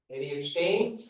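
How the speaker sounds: usual speaking voice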